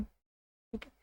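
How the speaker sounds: ordinary delivery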